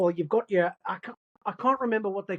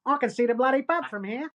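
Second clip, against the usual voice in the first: broad Australian accent, raised pitch; rougher-style Australian dialect demo; Furiosa Australian accent; 5 semitones higher than usual. Shown as a demonstration. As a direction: do a strong Aussie accent. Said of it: "Do the Furiosa one again".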